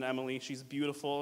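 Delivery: ordinary delivery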